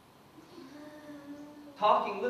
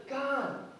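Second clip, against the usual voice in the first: mild fading plea; 3 semitones lower, 7 dB quieter than usual. Retold or imitated. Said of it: "Jesus says".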